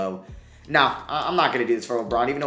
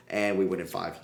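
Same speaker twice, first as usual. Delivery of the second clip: ordinary talk, nothing marked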